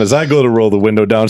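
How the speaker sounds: ordinary talk, nothing marked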